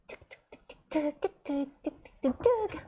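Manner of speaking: ticking noise that starts whispered, then goes high; ticking sound. Retold or imitated. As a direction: tick out the sound like a clock. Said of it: "It really is like".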